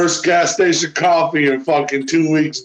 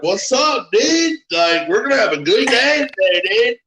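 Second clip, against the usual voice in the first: much higher, bouncy upbeat hello; overly cheery greeting; 7 semitones higher than usual. Retold or imitated. To the grumpy driver, pushing an upbeat high greeting. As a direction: beam at him like nothing can spoil it. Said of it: "I'm like".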